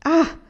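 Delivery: sharp high indignant "Ah!"; high, loud angry exclamation; angry cry. Acted. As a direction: burst out in anger. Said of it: "gridò Anania adirandosi".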